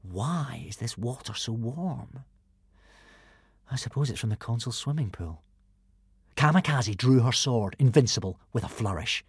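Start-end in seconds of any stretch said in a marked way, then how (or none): 0.0-5.4 puzzled murmur. A narrator's reading. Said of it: "frowning to himself".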